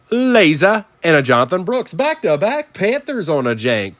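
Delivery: high-pitched excited hit call; excited card reveal. Real, not acted. Host calling back-to-back Panthers to collectors, excited. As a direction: announce the hit, voice jumping up high.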